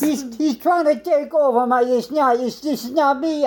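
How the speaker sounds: frantic high-pitched pleading with the boss; desperate man. Acted. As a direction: beg the boss to believe you. Same desperate role.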